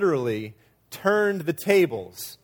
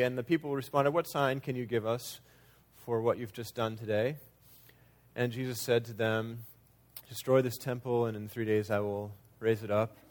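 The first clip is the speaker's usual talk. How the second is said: flat Bible reading, no expression, trailing softer; monotone reading; 4 semitones lower, 6 dB quieter than usual. Shown as a demonstration. Preacher showing how we read the Bible, monotone. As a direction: read the verse in a soft, even monotone. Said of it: "read it in a monotone".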